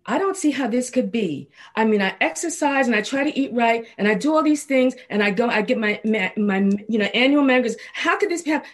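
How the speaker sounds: retelling her panic to a friend, voice climbing; elevated, frantic. Retold or imitated. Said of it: "I was a little elevated in my craziness".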